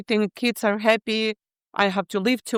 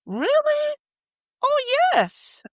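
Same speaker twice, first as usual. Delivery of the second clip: surprised disbelief; slower than usual. Retold or imitated. Retelling her own surprised reply.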